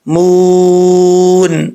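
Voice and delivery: مون held very long at the stop; long madd. Shown as a demonstration. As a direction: stretch مون out for the pause. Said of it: "دو طرح کی مدیں". (مون کو لمبا کھینچ کر)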